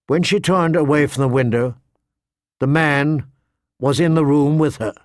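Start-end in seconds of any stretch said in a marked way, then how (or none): none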